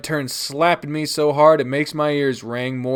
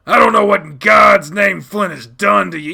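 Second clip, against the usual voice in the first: loud, raised fatherly exasperation; angry shout; 7 semitones higher, 10 dB louder than usual. Acted. Dad blaming Flynn for child's change, angry shout. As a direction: bellow your bafflement at the child.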